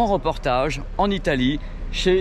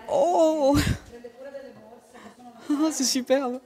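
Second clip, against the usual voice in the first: amazed; 10 semitones higher, 3 dB quieter, much slower than usual. Real, not acted. Amazed on entering the factory.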